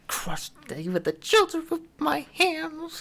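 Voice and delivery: high, anguished crying wail. Acted. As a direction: wail it like breaking down in tears.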